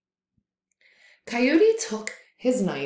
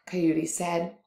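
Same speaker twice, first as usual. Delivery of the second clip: plain reading voice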